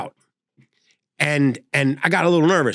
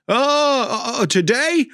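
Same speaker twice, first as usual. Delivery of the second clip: put-on confused old man; 9 semitones higher, slower than usual. Elderly TV installer confused.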